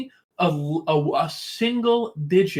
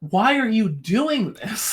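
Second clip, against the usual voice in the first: exasperated; 6 semitones higher, slower than usual. Real, not acted. Exasperated plea to teams.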